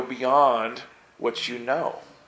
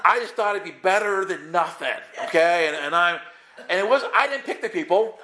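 laughing through the excuse, pitch higher; laughing defense; 6 semitones higher than usual. Real, not acted. Preacher laughing as he retells his excuses to the student. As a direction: chuckle while protesting it wasn't your fault.

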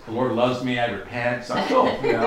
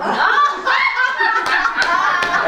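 big laugh; 7 dB louder than usual. Real, not acted. Laughing at own comeback.

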